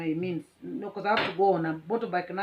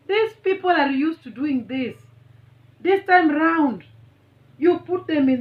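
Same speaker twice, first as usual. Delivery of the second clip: approving rider; 8 semitones higher, 7 dB louder than usual. Retold or imitated. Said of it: "he tells me".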